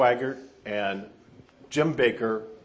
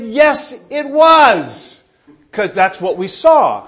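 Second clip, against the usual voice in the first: high, loud, insistent argument; shouted protest; 9 semitones higher, 14 dB louder, much slower than usual. Retold or imitated. Young pastor contradicting God about the fall, shouted protest. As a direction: argue back at full volume.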